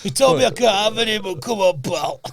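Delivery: high pleading put-on voice; lightly hammed-up pleading; mock fat man voice. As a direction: wheedle like a greedy big man. Same voice continues.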